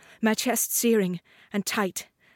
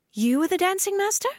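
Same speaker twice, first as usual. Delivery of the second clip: put-on much higher girl's voice, rising in wonder; surprised girl; 11 semitones higher than usual. Girl meeting the dancing master, high surprised question. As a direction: ask it wide-eyed and young.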